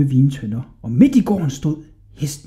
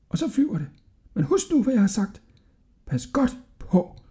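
put-on high-pitched, easy-going old man; old shopkeeper explaining; 6 semitones higher than usual. A narrator's reading. El Fazer telling Hodja how to fly it, high and confiding. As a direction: explain it as the simplest thing, high-voiced.

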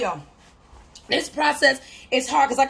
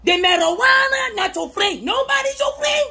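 preacher shout; 10 semitones higher than usual. Acted. Testifying to viewers about vices, shouting high.

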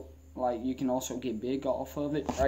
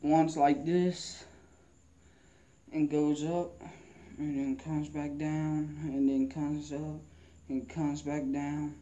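slow demonstrating; 5 dB quieter, slower than usual. Shown as a demonstration. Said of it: "shows the curl motion".

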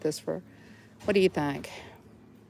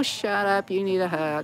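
normal talking voice

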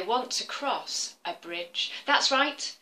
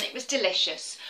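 ordinary delivery, nothing marked

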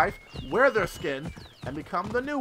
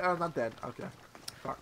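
usual speaking voice

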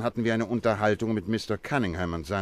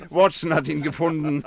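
laughing; 9 semitones higher, 5 dB louder than usual. Acted. Holmes amused at Watson's mishap.

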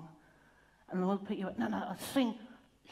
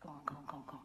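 unvoiced urging whisper; 9 semitones lower, 17 dB quieter, much faster than usual. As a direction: hush and urge them on.

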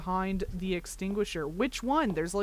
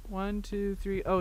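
ordinary delivery

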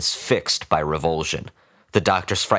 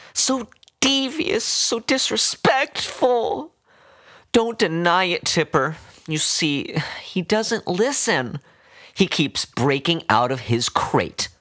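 high, slow voice of a fussy pet owner scolding; doting old woman; 9 semitones higher than usual. A narrator's reading.

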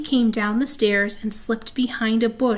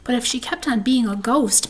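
usual speaking voice

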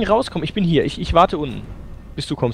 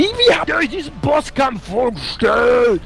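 slow, higher, shouted complaint at the game makers; exasperated shout; 5 semitones higher, slower than usual. Real, not acted. Gamer shouting at the game's developers, slow exasperated yell. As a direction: drag the question out in a shout of disbelief.

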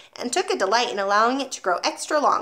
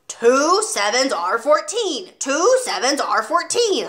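louder, slow pounding bellow; 4 semitones higher, 5 dB louder, slower than usual. A narrator's reading. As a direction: roar each word at the boy. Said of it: "Miss Trunchbull bellowed".

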